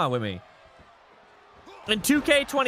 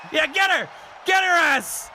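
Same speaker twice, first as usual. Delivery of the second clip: egging on the attack; hyped yelling at the screen; excited cheering; 8 semitones higher than usual. Real, not acted. Cheering on a takedown.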